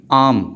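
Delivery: "आम" drawn out on a long aa; long vowel. Shown as a demonstration. Teacher giving a long-vowel example, stretched "आम". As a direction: hold the aa of "आम", r silent. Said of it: "with example समझिए".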